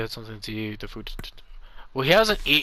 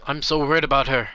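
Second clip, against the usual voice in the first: worried mother; 6 semitones higher than usual. Acted. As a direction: plead like a frightened parent. Reads worried mother dialogue.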